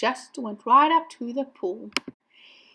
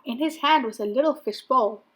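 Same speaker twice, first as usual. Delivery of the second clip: ordinary delivery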